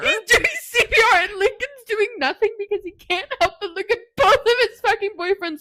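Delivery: real distress breaking into tears; overwhelmed by a heartbreaking book scene, crying out; crying shout. Real, not acted. Emotional climax, near crying.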